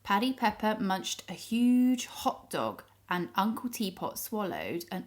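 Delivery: plain reading voice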